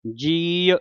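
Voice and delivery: ਜੀਅ said as one short syllable ji, not jiaa; ਜੀਅ clipped to ji with no second vowel; ਜੀਅ ਨੂੰ ਜੀ ਬੋਲੋ. Shown as a demonstration. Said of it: "ਜੀਆ ਨਹੀਂ ਕਹਿਣਾ".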